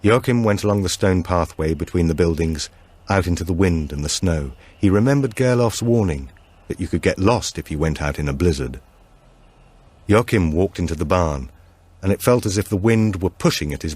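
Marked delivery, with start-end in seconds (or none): none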